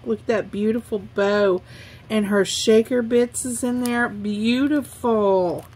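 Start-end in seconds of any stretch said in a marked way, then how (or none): none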